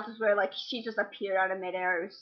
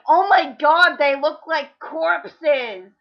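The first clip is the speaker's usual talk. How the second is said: loud, slow mock yell; retold yell; 5 semitones higher, 8 dB louder, much slower than usual. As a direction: shout it across the cinema. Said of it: "someone actually yelled".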